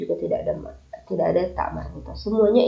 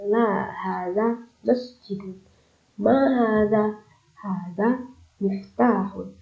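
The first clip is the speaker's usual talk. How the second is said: slow, drawn-out Arabic reading; much slower than usual. Shown as a demonstration. Teacher reading Arabic dialogue to her students, slow and clear. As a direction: read each Arabic phrase very slowly. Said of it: "apakah ini sebuah rumah?".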